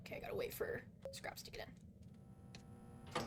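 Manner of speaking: soft hushed whisper. Real, not acted. Gamer waiting for Scraps while hiding, whispering. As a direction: keep it barely audible.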